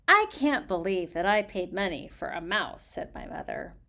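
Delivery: expressive storybook reading voice; reading aloud. Acted. Reading a children's author's letter to her audience, expressive storybook voice. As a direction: read the letter to listeners, lively.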